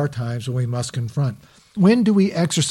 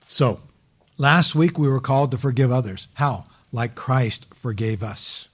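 usual speaking voice

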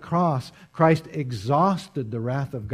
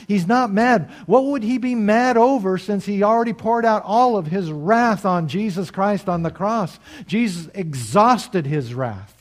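louder, rising preacher's push; impassioned preaching; 8 semitones higher, 6 dB louder than usual. Acted. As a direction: drive it home to the congregation. Preaching at full force.

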